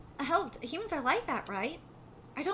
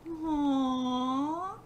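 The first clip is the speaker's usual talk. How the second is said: long, whimpering coo; touched "aww"; 2 semitones lower than usual. Real, not acted. Reader swooning over the sweet scene, cooing. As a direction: draw the aww out, melting.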